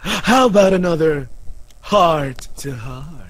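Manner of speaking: slow, drawn-out theatrical delivery; hammed-up dramatic character voice; melodramatic line reading. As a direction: stretch the words out like a stage actor. Reading game character's dramatic lines.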